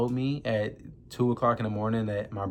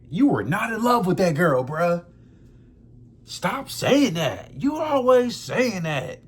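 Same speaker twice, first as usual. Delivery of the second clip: high-pitched, mock-indignant telling-off; imitated brother's exasperated outburst; exasperated scolding; 7 semitones higher, 4 dB louder, slower than usual. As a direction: scold your brother in drawn-out, raised-pitch protest. Acting out brother's scolding over girlfriend.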